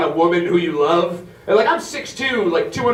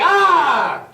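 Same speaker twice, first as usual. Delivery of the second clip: mock intruder yell; 10 semitones higher than usual. Breaking in, yelling at wife.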